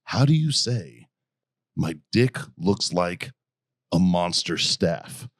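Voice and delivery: half-hearted mock of a clumsy Marine. Marines asking Japanese people for a crude phrase, said slow. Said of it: "you'd be like".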